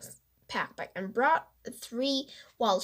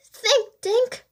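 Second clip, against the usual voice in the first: sharp self-command, pitched higher and louder; ordering himself; 13 semitones higher, 8 dB louder than usual. A narrator's reading. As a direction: snap an order at yourself. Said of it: "he ordered himself".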